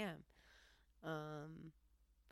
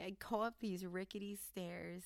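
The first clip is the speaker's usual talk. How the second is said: ordinary delivery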